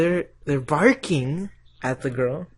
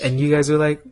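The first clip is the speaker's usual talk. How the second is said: ordinary talk, nothing marked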